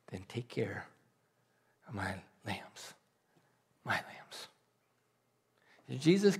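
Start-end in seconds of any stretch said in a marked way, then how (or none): 0.1-4.5 tender reply. Acted. Jesus answers Peter tenderly.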